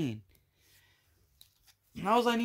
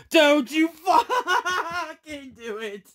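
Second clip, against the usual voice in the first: high and loud, laughing through the words; laughing plea; 8 semitones higher, 6 dB louder, much slower than usual. Real, not acted. Collector laughing at his pack, pleading through laughter. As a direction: beg the pack while cracking up. Begging the next card, laughing.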